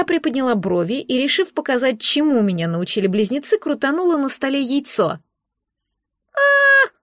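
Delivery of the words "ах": very high, loud yelp from a tiny creature; hysterical squeak. A narrator's reading. The spun egg cries out, hysterical squeak. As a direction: squeal in sudden panic. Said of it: "раздался истеричный писк".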